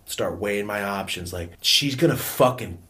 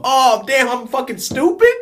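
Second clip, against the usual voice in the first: high, loud mock outrage; shocked dismay; 13 semitones higher, 8 dB louder than usual. Kid told about special class, shocked dismay. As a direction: cry it out in appalled disbelief. Acts out shocked reaction to placement.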